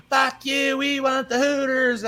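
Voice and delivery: heckling concertgoer. Retold or imitated. Comic retelling a fan heckling him, high mocking voice. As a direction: sneer it up high like a rude fan on shoulders. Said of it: "He's like".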